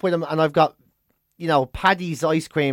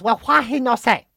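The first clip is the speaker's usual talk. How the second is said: put-on caricatured Chinese-accented English, slightly raised; imitated Chinese accent; 4 semitones higher than usual. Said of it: "You gotta put on their accent".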